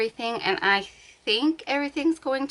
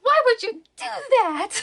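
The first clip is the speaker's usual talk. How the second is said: much higher, louder whiny complaint; cat's voice; 14 semitones higher, 6 dB louder than usual. Pretend. Cat complaining to mom about being picked up. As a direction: voice the cat's indignant protest. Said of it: "She's like".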